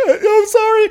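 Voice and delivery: high, loud mock sobbed sorry; imitating crying girlfriend apologizing; mock wailing apology. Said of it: "outpouring of".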